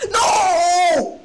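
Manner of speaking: loud, high cry of protest. Real, not acted. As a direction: shout it out in disbelief. Opponent escapes with pawn.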